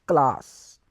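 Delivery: class said klas, short French a; French classe. Shown as a demonstration. Teacher modelling classe for the class. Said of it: "we say".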